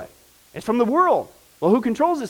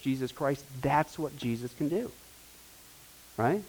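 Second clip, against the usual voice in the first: ordinary delivery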